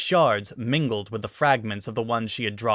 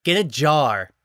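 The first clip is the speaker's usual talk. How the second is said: curt, louder retort; spat; 4 semitones higher, 5 dB louder than usual. Acted. Samus spitting an order at the guard. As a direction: spit the words out with contempt. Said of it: "Samus spat".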